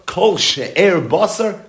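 "Kol she'er basar" drawn out very slowly and pitched high; emphasis on she'er basar. Shown as a demonstration. Teacher stressing the key Mishnah phrase to his students, slow and emphatic. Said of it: "emphasis on she'er basar".

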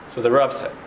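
usual speaking voice

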